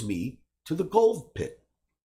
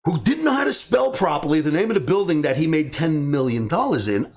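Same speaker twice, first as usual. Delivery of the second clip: ordinary delivery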